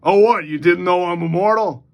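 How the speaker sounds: mock-surprised question, lightly in character; Lobo. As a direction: play it as a teasing character line. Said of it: "Lobo looks at him and goes".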